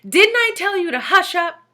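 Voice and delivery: high, loud scolding question; rounding on the pupil, loud and high; angry teacher. A narrator's reading. Teacher scolding Todd, high loud angry demand. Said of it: "she demanded".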